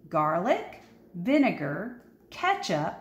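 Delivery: plain reading voice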